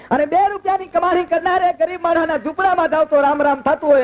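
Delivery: high-pitched, ringing storyteller's declamation; lifted oratory. Acted. Folk performer proclaiming saints' glory to the audience, lifted oratory. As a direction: proclaim each line up high to the crowd.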